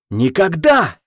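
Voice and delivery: roaring grunting brute. Acted. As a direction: bellow it in rage. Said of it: "Горыня взревел". (яростный рёв)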